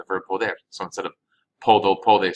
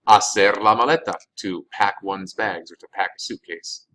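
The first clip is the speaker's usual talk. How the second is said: usual speaking voice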